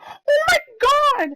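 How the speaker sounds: high, loud, drawn-out shriek; horrified scream. Retold or imitated. Teacher catching a kid misbehaving, horrified scream. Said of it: "she starts screaming at me".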